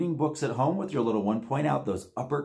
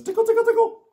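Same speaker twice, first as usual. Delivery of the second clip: very high, loud tickle squeal; tickling squeal; 6 dB louder, much faster than usual. Shown as a demonstration. Host tickling the monster's feet, very high squeal. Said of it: "can you tickle your little one's feet?".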